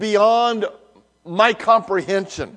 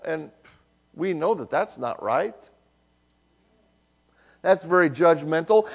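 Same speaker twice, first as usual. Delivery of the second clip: usual speaking voice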